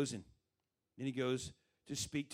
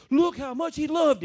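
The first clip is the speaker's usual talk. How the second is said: high, very loud exclaiming; acted exclamation; 13 semitones higher, 13 dB louder than usual. Onlookers see Jesus weep, loud high exclamation. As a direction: cry it out like an amazed onlooker.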